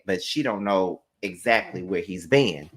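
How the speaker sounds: hushed gossipy whisper; whispered aside. Real, not acted. Recapping Trisha's story to viewers, dropping into a whisper. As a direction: lean in and drop to a whisper.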